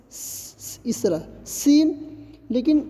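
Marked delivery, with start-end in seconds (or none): 0.1-0.8 س- as a soft voiceless hiss, no voice; hissing demo. Shown as a demonstration. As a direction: breathe out س- as a sharp hiss. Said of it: "normally پڑھیں گے".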